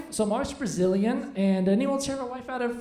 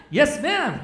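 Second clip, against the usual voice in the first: high, loud, hammed-up compliance; playfully over-keen yes; mock-eager agreement; 6 semitones higher, 6 dB louder than usual. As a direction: jump at it with acted eagerness. Retelling his eager reply to wife.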